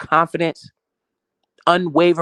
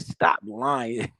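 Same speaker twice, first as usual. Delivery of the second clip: imitating her disbelief; 3 semitones lower, 5 dB quieter than usual. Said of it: "She said".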